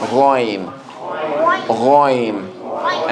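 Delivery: ghoin with a throaty gh; model pronunciation. Shown as a demonstration. Teacher models ghoin for the class to repeat. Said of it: "So everyone say that?".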